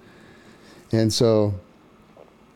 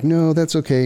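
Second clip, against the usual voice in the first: high, louder firm no; firm refusal; 10 semitones higher, 3 dB louder than usual. Retold or imitated. Woman refuses again at her door, high and firm.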